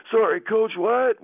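high, loud confused apology; dazed apology. Retold or imitated. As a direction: call out dazed and hurt to the coach. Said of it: "I'm like".

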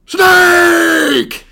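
loud shouted cry. Retold or imitated. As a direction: yell it out. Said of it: "is what I wrote down".

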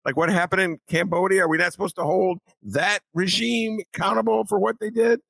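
high-pitched, incredulous rhetorical questioning; indignant disbelief. Real, not acted. Host challenging the idea that standards change, indignant. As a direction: pile up questions in rising disbelief. Indignant rebuttal of the cartoon's excuse.

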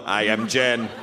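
mock robot monotone. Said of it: "You said that like a robot".

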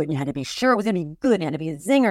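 normal talking voice

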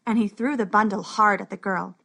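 ordinary delivery, nothing marked

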